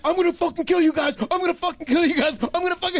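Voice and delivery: high-pitched, repeated mock-furious threat; imitated raging threat. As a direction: imitate a raging friend, voice pushed up. Imitating friend's threats while attacking.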